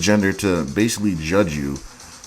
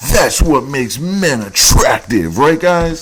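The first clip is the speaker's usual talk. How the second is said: slow, louder sarcastic read of her boast; mocking her bragging to the guys; 5 semitones higher, 6 dB louder, slower than usual. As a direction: drag it out mockingly, higher. Mocking her profile claims.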